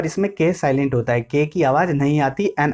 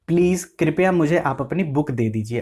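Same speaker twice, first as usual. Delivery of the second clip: ordinary talk, nothing marked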